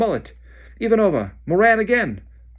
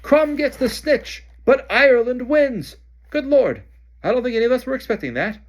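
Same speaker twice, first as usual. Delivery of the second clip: stunned announcer; 14 semitones higher, 7 dB louder than usual. Acted. Bagman announcing the surprise result to the crowd. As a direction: announce it in disbelief.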